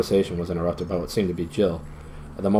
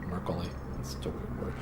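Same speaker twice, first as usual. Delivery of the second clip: whispered aside; 4 semitones lower, 14 dB quieter than usual. Real, not acted. Aside on an odd word.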